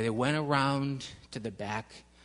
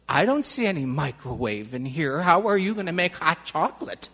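put-on curious child; 9 dB louder than usual. A narrator's reading. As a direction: ask it loud and baffled like a young girl. Annie questions Mr Jones.

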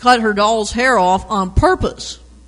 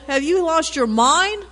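high, raised scolding cry from mom; yelling mom; 7 semitones higher than usual. Acted. Scolding shout, pitched high. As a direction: mom catching him throwing ball through window. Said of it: "she yelled".

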